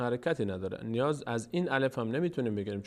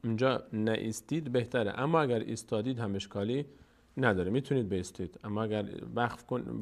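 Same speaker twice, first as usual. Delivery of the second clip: normal talking voice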